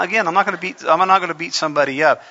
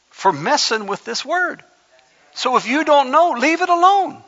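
fiery preaching; 8 semitones higher than usual. Acted. Preacher warns his church against twisting scripture, fiery full-force preaching. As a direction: preach it hard, warn the church.

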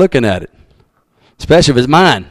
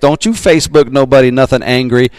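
ordinary talk

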